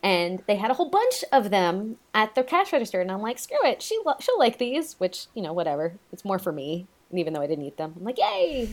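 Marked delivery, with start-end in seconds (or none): 3.4-5.0 retold carefree decision. Quotes own decision at register. 8.2-8.7 high, drawn-out mock cheer; retold glee. Mimics own delight at candy.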